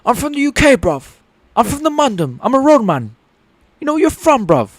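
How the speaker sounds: hammed-up London roadman accent. Streamer playing a roadman for laughs, UK accent. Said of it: "I just like doing the U K accent".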